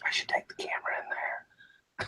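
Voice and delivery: very soft whispered aside; hushed whisper. Real, not acted. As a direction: whisper it, someone is asleep nearby. Buddy asleep, keeping quiet.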